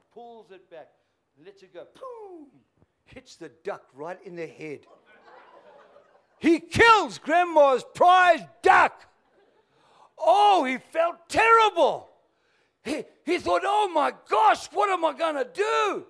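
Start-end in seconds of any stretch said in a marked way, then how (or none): 1.9-2.5 high, punchy boom; sound effect. Retold or imitated. Stone hits the duck. 6.4-13.6 preaching shout. A narrator's reading. Preacher climaxes duck killing. 13.6-16.1 panicked cry. Acted. Boy panics over dead duck.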